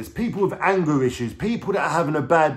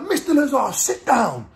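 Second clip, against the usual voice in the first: high-pitched judge impression, barking an order; angry judge; 12 semitones higher, 4 dB louder, slower than usual. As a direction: imitate a judge cutting off the defendant.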